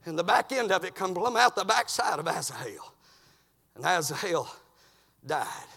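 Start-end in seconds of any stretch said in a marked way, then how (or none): none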